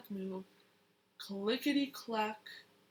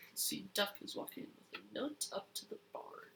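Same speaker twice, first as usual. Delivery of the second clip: whisper; 2 semitones lower, 11 dB quieter than usual. Real, not acted. Child whispers an aside.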